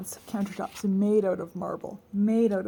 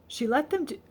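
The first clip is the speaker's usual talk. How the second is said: plain reading voice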